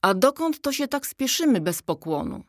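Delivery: high-pitched, gruffly haughty challenge; surly. A narrator's reading. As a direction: stop a passer-by with a surly question. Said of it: "Zatrzymał ją opryskliwy głos".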